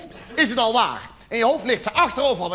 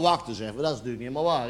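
ordinary talk, nothing marked

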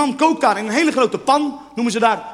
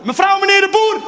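high, loud sing-out for pets; raised, carrying outdoor call; calling outside; 8 semitones higher, 5 dB louder than usual. Acted. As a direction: call them in from across the street. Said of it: "als je ze buiten gaat roepen".

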